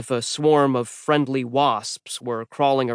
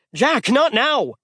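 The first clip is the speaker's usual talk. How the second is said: higher, slow frantic plea; panicked shout; 12 semitones higher, 3 dB louder, slower than usual. A narrator's reading. Magnus falling, telling Jack not now, panicked. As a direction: wave him off mid-fall. Magnus flailing mid-fall.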